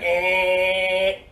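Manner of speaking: long held "eh" buzz; alarm clock buzzer. Pretend. Coach ending kids' tick-tock game, droning buzzer noise. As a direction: imitate an alarm going off.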